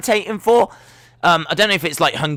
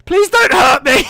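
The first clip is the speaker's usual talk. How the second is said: mock terrified; 11 semitones higher, 6 dB louder than usual. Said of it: "She has to go".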